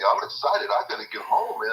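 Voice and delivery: normal talking voice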